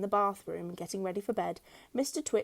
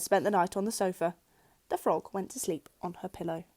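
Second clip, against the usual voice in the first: ordinary delivery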